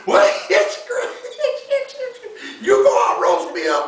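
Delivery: high laugh then a loud taunt; laugh, then shout the dare. Acted. Tied-up rabbit daring the fox, laughing then shouting.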